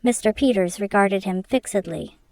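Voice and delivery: plain reading voice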